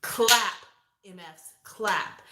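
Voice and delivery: slow, heavy command; "Clap" barked loud, then slow and falling. Shown as a demonstration. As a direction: give it gravity like an order. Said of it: "You wanna be like".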